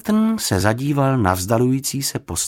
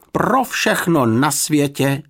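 mild dismayed cry, slow and raised; high-pitched housekeeper's protest; exclaiming; 6 semitones higher, 4 dB louder, slower than usual. A narrator's reading. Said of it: "vykřikla paní Ptáčková".

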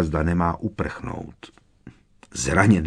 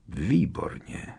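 soft, higher-pitched villain's approval; Voldemort speaking quietly; 4 semitones higher, 6 dB quieter, slower than usual. A narrator's reading. Villain approving once Harry is bowed, quietly. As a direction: say it softly, with quiet satisfaction. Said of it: "řekl potichu Voldemort".

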